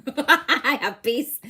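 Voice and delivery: slow laughing repeat; amused, laughing self-reassurance. Real, not acted. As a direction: laugh it out slowly. Laughs at frustration.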